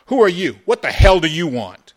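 loud, high demand; angry challenge. A narrator's reading. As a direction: bellow at the stranger to explain himself. Gunman challenges the newcomer.

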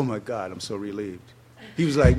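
normal talking voice

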